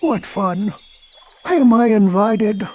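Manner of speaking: high cheerful cartoon bear; Pooh voice. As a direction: play Pooh, delighted and hopeful.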